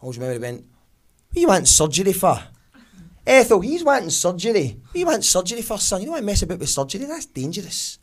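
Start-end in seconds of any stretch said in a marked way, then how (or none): 1.3-8.0 dad's voice. Retold or imitated. Dad to son asking about back surgery, higher fussy dad. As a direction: fret like an old Glasgow dad.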